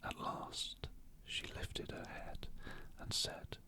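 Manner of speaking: whispered bedtime audiobook narration; whispered story reading. A narrator's reading. Reader lulling listeners to sleep with a mystery chapter, whispering. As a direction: read the story in an unhurried whisper.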